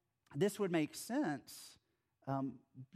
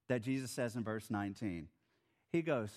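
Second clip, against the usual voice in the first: normal talking voice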